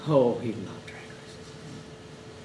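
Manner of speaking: low breathless half-whisper; soft wistful widow. Retold or imitated. Widow recalling her husband's love of racing, faint whisper. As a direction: let it slip out, barely voiced.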